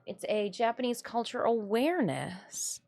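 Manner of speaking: usual speaking voice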